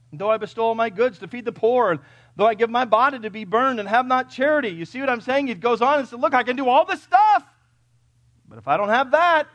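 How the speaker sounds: high, loud, driving pulpit delivery; full-force preaching. Acted. Preacher driving home charity to the congregation, full-force preaching. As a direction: preach it at full volume, building to a peak.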